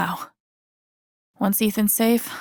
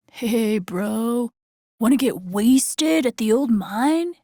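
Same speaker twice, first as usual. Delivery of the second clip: lazy slowed party-bro voice, lightly done; a hint of a dumb teenager inviting a friend; mock teen partier; 3 semitones higher, slower than usual. She imagines the local teens, lightly mocking bro voice.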